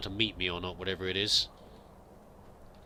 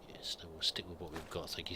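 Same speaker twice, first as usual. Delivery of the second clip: whisper; 4 semitones lower, 10 dB quieter than usual. Real, not acted. Aside while looting.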